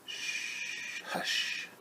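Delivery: long soft shush, then hush; whispered hush. Acted. Mother hushing animals near sleeping baby, whispered.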